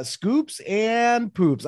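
high, very slow, savoring each word; playful drawn-out echo. Acted. Cohost repeats the segment name, high and drawn out. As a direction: stretch it out like a game-show catchphrase. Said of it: "you have any scoops and poops this week".

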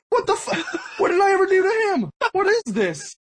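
very high-pitched, incredulous protest; mock outrage. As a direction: imitate a wounded, flabbergasted friend. Friend's retold outrage at the snub.

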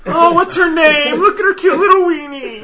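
high, cooing, laughing; cooing baby talk. Pretend. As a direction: coo over a baby, laughing.

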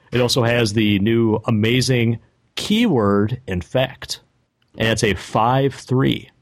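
slow, drawn-out reveal; slow roll. Shown as a demonstration. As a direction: read it out slowly for suspense. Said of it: "Just slow roll it a little bit for me".

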